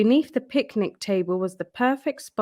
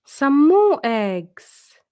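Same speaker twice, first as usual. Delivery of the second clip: high, louder and slow in wonder; delighted discovery; 6 semitones higher, 7 dB louder, much slower than usual. Acted. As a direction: savour the find, high and slow. Delight at finding eggs.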